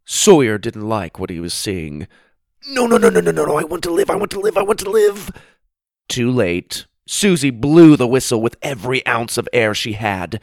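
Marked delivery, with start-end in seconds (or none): 2.7-5.3 high frantic begging, rushing the last words; panicked pleading. Acted. Sawyer begging his sister not to blow, high frantic panic. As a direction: beg for your life in a rising panic.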